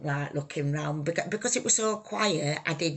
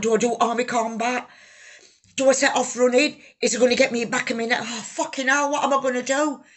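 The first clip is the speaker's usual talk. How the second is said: higher and louder, rushed panic; panicked thoughts; 6 semitones higher, 7 dB louder, faster than usual. Retold or imitated. Shot in the leg and wondering what to do, panicked. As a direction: blurt the fear out fast.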